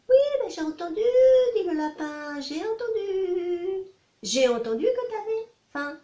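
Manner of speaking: lapin très aigu, lent; voix de lapin. Acted. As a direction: petite voix haute et traînante. Rabbit answers, high. (lapin qui répond d'une voix très haute)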